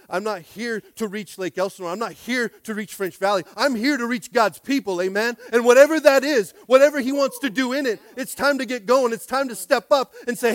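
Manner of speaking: ordinary talk, nothing marked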